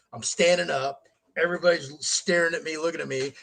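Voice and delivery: normal talking voice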